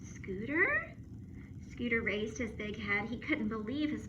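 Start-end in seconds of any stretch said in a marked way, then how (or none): 0.0-0.9 higher, questioning call; girl spots her lost dog; surprised call. Acted. Lucy finding her dog.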